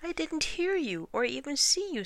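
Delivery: high-pitched protesting iguana; high, defensive creature. A narrator's reading. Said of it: "said the iguana".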